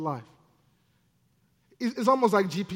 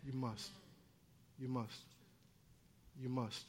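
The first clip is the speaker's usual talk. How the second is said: much softer and lower, nearly under the breath; hushed repetition; 7 semitones lower, 20 dB quieter than usual. Real, not acted. Preacher pressing the point on the congregation, hushed. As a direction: let it sink in, hushed.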